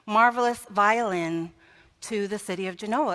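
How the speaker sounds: ordinary delivery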